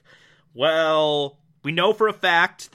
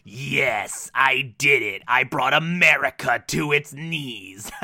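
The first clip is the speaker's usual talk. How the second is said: smug inner gloating; 4 semitones lower, slower than usual. Retold or imitated. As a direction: gloat privately, pleased with yourself. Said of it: "in his inner monologue going".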